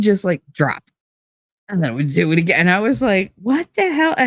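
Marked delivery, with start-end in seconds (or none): 3.4-4.1 much higher, baffled outcry. Retold or imitated. First contractions catch her off guard, much higher and baffled. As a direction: squeak it out in disbelief.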